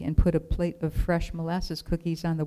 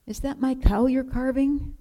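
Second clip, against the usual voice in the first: put-on eager boy; 8 semitones higher than usual. A narrator's reading. Boy asks the woodcarver.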